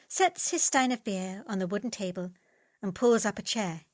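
usual speaking voice

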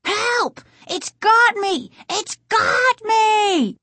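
very high, panicked shriek for help; panicked cries, stretched out at the end; terrified scream. A narrator's reading. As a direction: scream as if something has grabbed you. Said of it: "Casey's terrified scream".

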